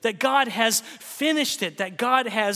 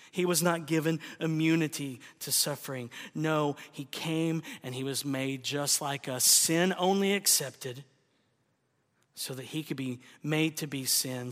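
much softer, lower, near-whispered sermon voice; hushed preaching; 6 semitones lower, 7 dB quieter than usual. Acted. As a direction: drop to a hush over the cross.

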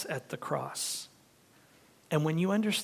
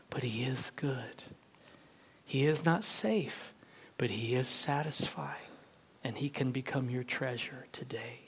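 soft, near-whispered assurance; tender hush; 3 semitones lower, 8 dB quieter than usual. Real, not acted. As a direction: draw them close, gently.

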